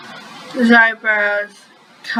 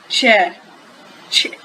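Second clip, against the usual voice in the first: plain reading voice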